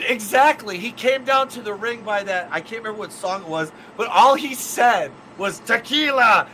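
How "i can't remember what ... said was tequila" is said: usual speaking voice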